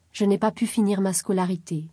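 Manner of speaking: usual speaking voice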